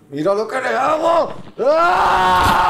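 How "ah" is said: high scream. Real, not acted. Scream at boss fight end.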